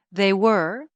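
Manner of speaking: ominous ending. A narrator's reading. Story's ominous closing line.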